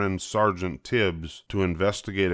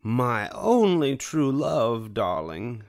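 higher, slow doting villainess voice; Cruella de Vil; 4 semitones higher, slower than usual. A narrator's reading. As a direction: drawl it lovingly to the fur. Cruella adores her fur.

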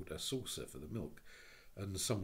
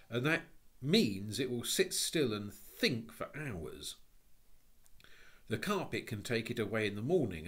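contemptuous; 4 semitones higher, 7 dB louder than usual. A narrator's reading. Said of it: "His tone was full of contempt".

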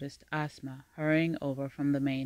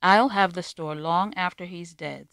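dismissive; 3 semitones higher, 7 dB louder than usual. A narrator's reading. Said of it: "with a dismissive shrug".

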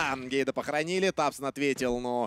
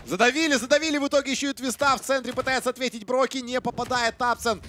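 excited; 13 semitones higher, 6 dB louder than usual. Real, not acted. Casters hyped at big play.

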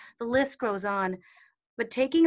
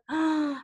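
gasp; 5 semitones higher, 4 dB louder than usual. Retold or imitated. Heartbroken at guidance.

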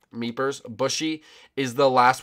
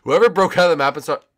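quick, indignant burst; rapid scolding; hurried accusation; 5 semitones higher, 12 dB louder than usual. Real, not acted. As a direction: fire it off fast. First, hurried take.

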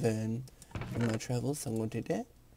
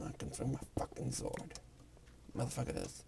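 whispered reprise; 11 dB quieter than usual. Real, not acted.

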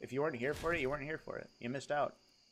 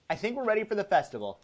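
fast, higher rattled-off line; quick repeat; 4 semitones higher, much faster than usual. Pretend. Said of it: "repeats the line faster".

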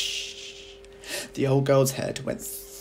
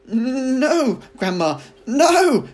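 higher, louder, slow pleading call; calling back no; 13 semitones higher, 11 dB louder, much slower than usual. A narrator's reading. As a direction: beg her to stop from below. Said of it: "George called back".

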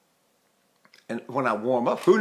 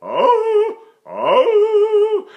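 much higher, louder held oh; high women's oh; 7 dB louder, much slower than usual. Retold or imitated. Voice teacher imitating female singers. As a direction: sing it like the women do. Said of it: "the women go".

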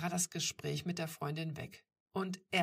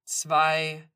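loud, level, threatening count; forceful ultimatum voice; stern countdown; 14 dB louder than usual. A narrator's reading. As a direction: call out the count firmly. Stranger continues the countdown.

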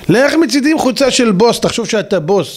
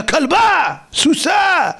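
high shouted insults, much higher; mock shouting insults; 7 semitones higher, much slower than usual. Preacher imitating a Knesset member yelling at a colleague, high mock shout. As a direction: yell it like a Knesset member losing it. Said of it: "ואחד צועק את השנייה".

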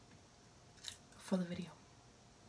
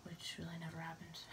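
whispered aside; 3 semitones lower, 21 dB quieter than usual. Real, not acted. Self-deprecating aside about comments.

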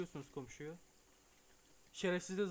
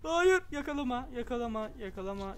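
begins as a high loud shout, then fades; begging not to be caught; panicked pleading; 6 semitones higher, 9 dB louder than usual. Real, not acted. Player begging the neighbor not to catch him, panicked.

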